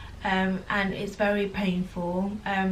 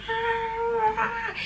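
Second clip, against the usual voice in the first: put-on very high squeaky baby "Ah"; imitating baby fussing; 14 semitones higher than usual. Mother imitating her newborn's fussing cry, high and squeaky. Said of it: "she'll be like".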